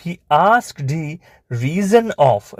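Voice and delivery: very slow, drawn-out words; slow dictation. Shown as a demonstration. Teacher dictating a story sentence to pupils, slow dictation. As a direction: dictate it slowly, stretching each word.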